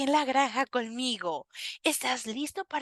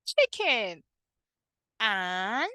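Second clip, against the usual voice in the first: ordinary talk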